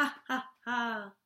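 slow mocking ha ha ha; sly wolf laugh. A narrator's reading. Wolf laughing at the cats it can smell.

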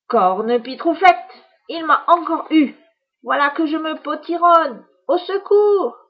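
high, loud witch wailing; muffled cry. Acted. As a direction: wail for help as a shrinking witch. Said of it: "Gémit la sorcière dans un cri étouffé".

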